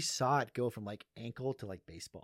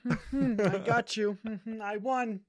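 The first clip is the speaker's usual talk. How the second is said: put-on higher Indian accent, musing hums; hammy Indian accent, mulling prices; 9 semitones higher, 5 dB louder than usual. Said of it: "make an impression of Abrar".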